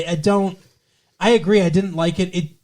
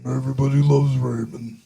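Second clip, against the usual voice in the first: sitcom character impression; 4 semitones lower than usual. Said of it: "I could do".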